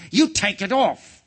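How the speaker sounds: loud, high, slow command; angry order. Acted. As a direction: order it emphatically, word by word. Angry king orders boy.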